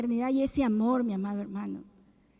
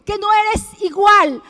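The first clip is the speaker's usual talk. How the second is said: louder, impassioned sermon voice; rising preacher's fervour; fervent preaching; 3 semitones higher, 9 dB louder than usual. Acted. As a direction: preach it to the congregation. Preaching to the congregation.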